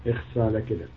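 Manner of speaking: low, soft disgusted tut; disgusted tsk. Real, not acted. Scolding the prophet's words, low disgusted tut.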